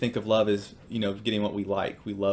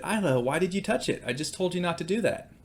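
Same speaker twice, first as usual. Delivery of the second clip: higher-pitched exasperated scolding, mildly acted; retold frustration; 7 semitones higher than usual. Dad to daughter who touched the grill, retold frustration. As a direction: re-enact scolding a child who disobeyed. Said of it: "I'm kind of frustrated".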